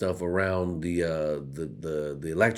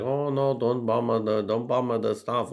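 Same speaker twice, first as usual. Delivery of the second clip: higher mock plea, a bit slow; mock pleading women; 6 semitones higher, 4 dB louder, slower than usual. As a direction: ham up a high pleading voice. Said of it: "trying to be like".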